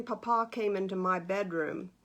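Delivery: ordinary delivery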